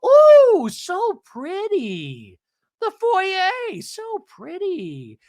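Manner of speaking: very high, drawn-out mock gushing; over-the-top swooning squeal; gushing impression. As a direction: coo loudly and stretch it out. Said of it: "Alexis, and goes".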